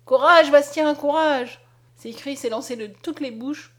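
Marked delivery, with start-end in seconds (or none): 0.0-1.6 loud, high cheer; encouraging shout. A narrator's reading. Crowd shouting to Bastien entering the fire, loud. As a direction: urge him into the fire. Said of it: "accompagné des cris de".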